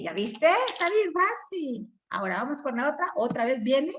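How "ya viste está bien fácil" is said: ordinary talk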